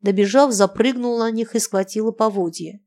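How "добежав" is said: usual speaking voice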